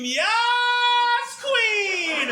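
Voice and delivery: high, excited yes queen; hyped yell. Retold or imitated. Sitting on the bidet, high excited cheer. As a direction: cheer it like a fan. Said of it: "wanted to scream".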